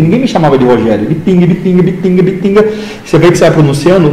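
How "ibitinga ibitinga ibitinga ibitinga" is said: "Ibitinga" chanted higher with a hard T; mimicked Ibitinga accent. Classmates parroting his hometown at him, Ibitinga accent. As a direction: repeat "Ibitinga" over and over in the local accent. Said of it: "Todo mundo fica falando".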